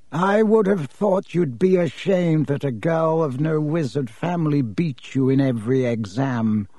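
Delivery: plain reading voice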